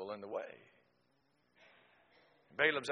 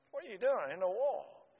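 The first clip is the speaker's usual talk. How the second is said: exasperated question; 9 semitones higher, 3 dB louder than usual. Retold or imitated. Balaam scolding the donkey.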